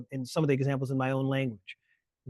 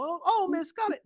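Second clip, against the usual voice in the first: much higher, fluttering mock plea. Host parodying Gone with the Wind's maid calling Scarlett, very high mock voice. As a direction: squeal it like the caricatured maid.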